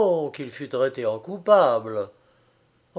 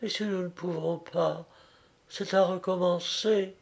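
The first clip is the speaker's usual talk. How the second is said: hushed, softer and slightly raised in pitch; low voice; 4 semitones higher, 6 dB quieter than usual. A narrator's reading. Bernerette murmurs last words to Frédéric, in a low voice. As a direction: drop to a near murmur. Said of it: "elle ajouta à voix basse".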